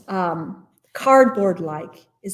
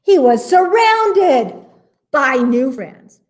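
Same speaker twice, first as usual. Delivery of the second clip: slow, high, loud storybook climax; big, joyful narrator's flourish; delighted reveal; 6 semitones higher, 8 dB louder, slower than usual. A narrator's reading. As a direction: announce the happy ending with drawn-out excitement. Happy reveal at story's end.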